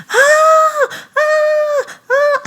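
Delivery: high, loud scream of tooth pain; retold pain scream, loud and high. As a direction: scream out in sudden agony. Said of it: "I started screaming".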